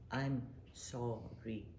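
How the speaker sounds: slow, soft I'm sorry; a slow, gentle apology shown as practice. Shown as a demonstration. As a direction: draw out I'm sorry slowly and softly. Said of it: "Practice it".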